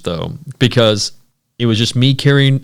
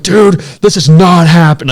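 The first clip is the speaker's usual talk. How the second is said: higher, louder panicked voice; panicked disbelief; 7 semitones higher, 8 dB louder than usual. Retold or imitated. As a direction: voice the fear rising. Retold panic in dead truck.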